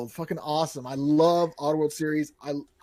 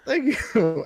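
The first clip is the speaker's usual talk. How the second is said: ordinary talk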